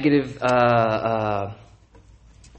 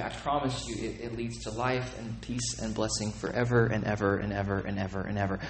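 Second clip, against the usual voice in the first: much softer and lower, tender and earnest; soft promise; 5 semitones lower, 11 dB quieter than usual. Real, not acted. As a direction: draw them in quietly with the promise.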